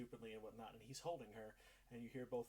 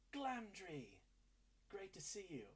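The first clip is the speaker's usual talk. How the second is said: higher, too-bright welcome that lingers; overly friendly greeting; 8 semitones higher, slower than usual. Acted. Impostor husband welcoming Glamdry at the door, a lingering too-friendly greeting. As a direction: greet him warmly but hold it too long. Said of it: "lingers a little too long".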